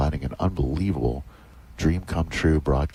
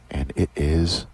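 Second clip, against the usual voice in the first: golf commentator; 3 semitones higher than usual. Real, not acted. Live putt call.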